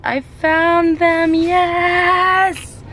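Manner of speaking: very high, drawn-out victory squeal; excited. Real, not acted. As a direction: stretch it out high, celebrating the find. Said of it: "I'm so excited I found them".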